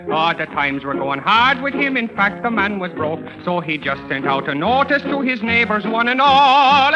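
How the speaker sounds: ordinary talk, nothing marked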